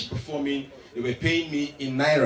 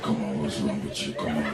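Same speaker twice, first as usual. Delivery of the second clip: much lower, coaxing man's voice; thick low voice; 11 semitones lower, 5 dB quieter, faster than usual. Retold or imitated. Matthew pressing the comedian, low and thick. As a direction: growl it low and persuasive. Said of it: "their voice gets thicker".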